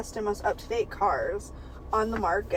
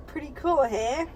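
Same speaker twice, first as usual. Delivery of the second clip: lightly voiced sultry Italian accent; sexy Italian accent; 4 semitones higher than usual. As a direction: purr it in a mock Italian accent. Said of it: "[name] asked with a sexy Italian voice".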